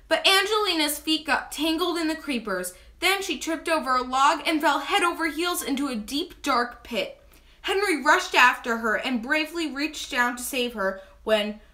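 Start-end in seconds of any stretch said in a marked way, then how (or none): none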